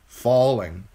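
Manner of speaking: usual speaking voice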